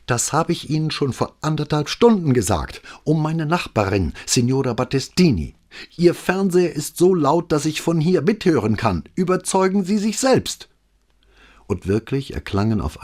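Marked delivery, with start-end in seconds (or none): 0.1-10.7 high, pushed, indignant voice; irate neighbour. A narrator's reading. Neighbour phoning the police about the loud TV, indignant. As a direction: press the complaint, barely holding it. Angry neighbour complains on phone.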